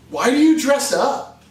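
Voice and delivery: normal talking voice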